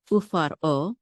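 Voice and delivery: Ufar o with a break before the o; separate syllable. Shown as a demonstration. Teacher showing the sheva's stop, o detached from Ufar. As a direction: stop on the r of Ufar, then start o afresh. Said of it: "this makes it a separate syllable".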